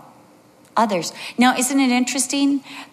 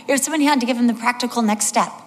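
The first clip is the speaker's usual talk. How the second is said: ordinary talk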